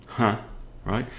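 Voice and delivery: ordinary talk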